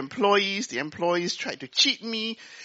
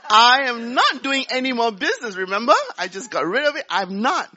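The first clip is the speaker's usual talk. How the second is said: louder, laughing protest; firm refusal; 4 semitones higher, 7 dB louder than usual. Retold or imitated. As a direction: wave the idea off, laughing. Wife refuses new business.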